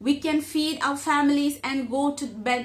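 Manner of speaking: slow, careful read-aloud of the English passage; measured classroom reading; slow textbook reading. Acted. Reads text aloud.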